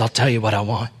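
low, soft, halting punchline; low exhausted reply, held back for effect; grim demand. Acted. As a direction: make a quiet, deadly serious demand, pausing between phrases. Exhausted swimmer delivers the grim punchline.

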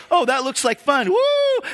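playful sheep voice rising to a squealed, high "Woo!"; giddy sheep. Retold or imitated. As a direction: play a careless sheep thrilled before the jump. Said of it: "the one sheep goes".

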